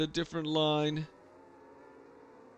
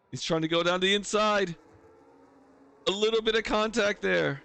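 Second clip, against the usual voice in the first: excited, higher race call; motorsport commentator; 6 semitones higher, 3 dB louder than usual. Real, not acted. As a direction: call the close fight with rising energy.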